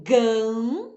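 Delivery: "gan" held long on a nasal vowel; teacher's drawn-out nasal "gan"; nasalized sound. Shown as a demonstration. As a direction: stretch the nasal hum in "gan". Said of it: "o som nasalizado".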